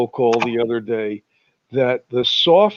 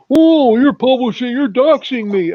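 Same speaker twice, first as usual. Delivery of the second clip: high whiny complaint, louder; mock the crybaby whining; 9 semitones higher, 4 dB louder than usual. Mocking doxers' complaints.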